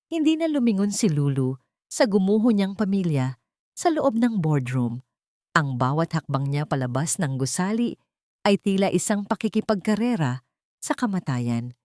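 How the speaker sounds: normal talking voice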